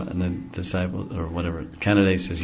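ordinary talk

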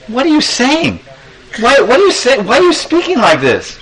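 high-pitched, disbelieving questions; dismayed mother. Acted. Mother Yashoda to her upset son, dismayed. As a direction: question the child in shocked concern.

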